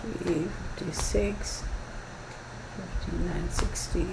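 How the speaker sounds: very soft, barely voiced count; counting to oneself. Real, not acted. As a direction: count the cards under your breath. Counting cards to herself.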